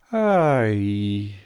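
langes, träges Seufzen; Seufzer. A narrator's reading. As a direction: seufz es schläfrig heraus. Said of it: "sagte das Faultier und seufzte". (schläfriges, langgezogenes Ai)

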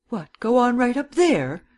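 high, loud protesting question; incredulous cry. A narrator's reading. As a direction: cry it out in disbelief. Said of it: "cried Miss Cummins".

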